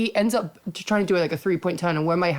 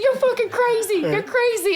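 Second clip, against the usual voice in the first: high mock voice of the rude driver; mocking the guy. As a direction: squeal his insult back at him. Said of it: "He's like".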